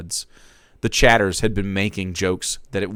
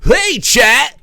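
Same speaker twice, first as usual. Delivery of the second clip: high, loud bright-eyed "Hey, chat!"; a chipper, higher stream opener; cheery streamer greeting; 9 dB louder than usual. Acted. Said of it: "Jake said, in his voice perfectly masking".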